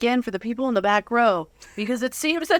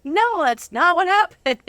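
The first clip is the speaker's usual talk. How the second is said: higher, louder, slow protest; emphatic denial; 9 semitones higher, 6 dB louder, slower than usual. Retold or imitated. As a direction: insist it didn't happen, loud and drawn out. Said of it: "And you're like".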